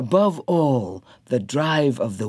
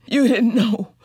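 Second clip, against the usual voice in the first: high, louder insistent Janey; Janey contending; 9 semitones higher, 3 dB louder than usual. A narrator's reading. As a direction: insist gently he couldn't know. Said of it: "Janey contended".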